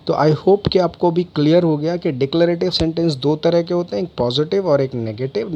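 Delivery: usual speaking voice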